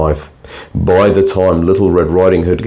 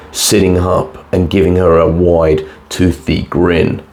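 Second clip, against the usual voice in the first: ordinary delivery, nothing marked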